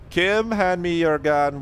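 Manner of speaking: slow high put-on French accent. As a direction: ham up a cartoon French cop. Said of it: "terrible French accent in me".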